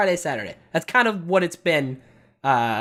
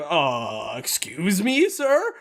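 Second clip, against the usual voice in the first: retold bafflement; much slower than usual. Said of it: "he looked up like".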